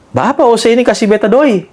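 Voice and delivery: a hint of Ambon accent, quoting the old man. Old man refusing the narrator's money, mild Ambon accent. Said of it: "Pakai logat Ambon kan".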